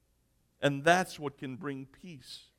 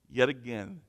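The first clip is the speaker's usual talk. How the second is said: ordinary talk, nothing marked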